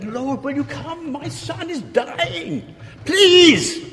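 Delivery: very high, slow, breaking into a loud please; pleading father, building to a cry; desperate begging father. Retold or imitated. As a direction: beg on your knees for your son. Official begging Jesus.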